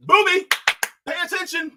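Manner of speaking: high, sharp call to attention; mock scolding. Host chiding an inattentive candidate, high mock scolding.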